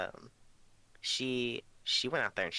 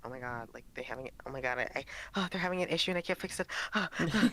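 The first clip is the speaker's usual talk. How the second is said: mock fretting, climbing higher and faster; people-pleaser panic; 4 semitones higher, much faster than usual. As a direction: act out a flustered people pleaser spiralling. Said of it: "I'm so people pleasy".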